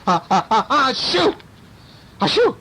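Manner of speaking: building gasps into high sneezes; acted sneezing. A narrator's reading. Burglar sneezing at Baby Bear, acted sneezing. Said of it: "and he's going".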